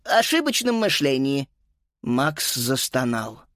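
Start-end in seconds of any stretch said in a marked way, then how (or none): 0.0-1.5 high, self-important recital; pompous. Acted. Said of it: "важно проговорила госпожа Пенне".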